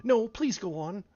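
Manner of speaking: humble. A narrator's reading. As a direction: ask meekly, drawn out. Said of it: "Alice said very humbly".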